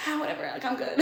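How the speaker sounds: soft, dismissive aside; imitated careless shrug of a reply; acted nonchalance. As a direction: brush it off under your breath. Acted nonchalance during quarrel.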